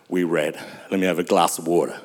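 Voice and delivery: rushed aside in a sunken, deep voice; voice gone deep. Real, not acted. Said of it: "my voice is getting very deep".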